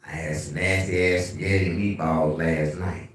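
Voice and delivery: ordinary talk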